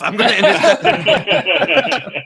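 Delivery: high, slow, laughing mock menace; laughing threat. Friend jokes about stealing the game in the mail, laughing. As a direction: tease him with a giggly slow threat.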